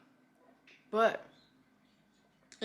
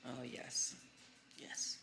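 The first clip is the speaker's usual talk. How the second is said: whispering; 7 semitones lower, 12 dB quieter, slower than usual. Real, not acted. Hushed reaction.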